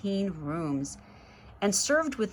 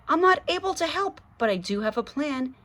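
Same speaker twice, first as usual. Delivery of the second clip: doctor voice; 7 semitones higher, faster than usual. A narrator's reading.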